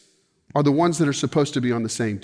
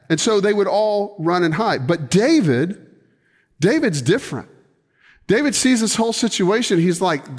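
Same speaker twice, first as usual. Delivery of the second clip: ordinary delivery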